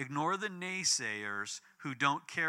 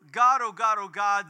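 fervent prayer cry; 3 semitones higher, 9 dB louder than usual. Retold or imitated. Acted prayer at the Supreme Court.

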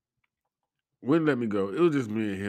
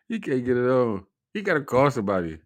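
ordinary talk

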